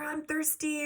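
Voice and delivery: mimicking a demanding son; whiny kid.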